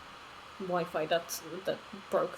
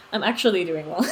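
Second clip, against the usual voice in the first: I'm actually doing well through a real laugh; 2 semitones lower, 6 dB louder than usual. Real, not acted. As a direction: laugh while reassuring them. Answering chat, two takes.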